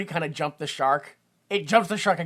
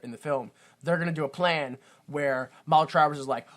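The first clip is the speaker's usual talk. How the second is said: ordinary talk, nothing marked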